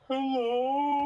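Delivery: put-on high, small pitiful kid voice; pitiful little kid. Character greeting passersby like a pitiful little kid. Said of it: "Like a pitiful little kid".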